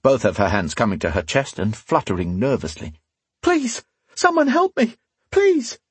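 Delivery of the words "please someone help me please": very high, shrieked cry for help; terrified plea. A narrator's reading. Woman calling the tavern men to save her, terrified plea. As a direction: plead as if panicked.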